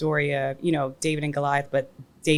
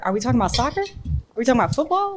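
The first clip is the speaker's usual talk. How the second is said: confused; 9 semitones higher, 3 dB louder, faster than usual. Real, not acted. Confused questions.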